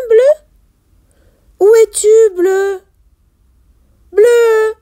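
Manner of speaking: high, loud repeated calling; searching call. Acted. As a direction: call out for a missing friend. Character calls for missing whale.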